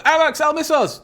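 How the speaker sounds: high-pitched badgering viewer; voicing a pushy chat message; insistent mock demand. Voices chat's repeated demand.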